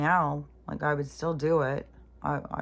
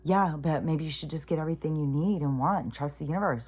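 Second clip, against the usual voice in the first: ordinary talk, nothing marked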